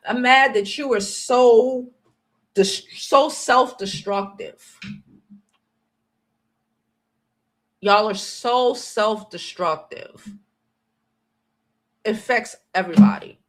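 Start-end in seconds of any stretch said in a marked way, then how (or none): 0.1-10.3 drawn out, pounding each word; slow, heavy scolding; slow emphatic anger. Real, not acted. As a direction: spell it out slowly in anger. Angry rant, slowed emphasis.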